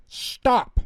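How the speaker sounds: very high, loud cry of stop; loud yell. Acted. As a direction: yell it to freeze him. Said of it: "Taya yelled very loudly".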